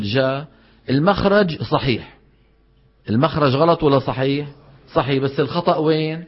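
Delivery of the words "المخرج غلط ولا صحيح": usual speaking voice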